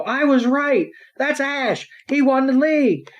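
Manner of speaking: high mock kid voice, thrilled; imitating an excited child. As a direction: squeak it like a starstruck little fan. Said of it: "then we hear one kid go".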